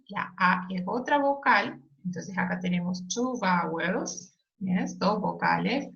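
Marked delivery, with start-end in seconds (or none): none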